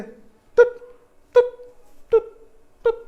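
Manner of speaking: high, loud imitated beeps; heart monitor beeps. The pastor mimics the monitor starting up, high beeps. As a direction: sound out the monitor blips.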